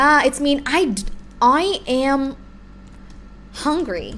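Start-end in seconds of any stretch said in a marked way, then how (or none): none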